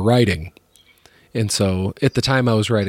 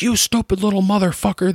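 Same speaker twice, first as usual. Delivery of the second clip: mock yell; 9 semitones higher than usual. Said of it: "yelling at 'em".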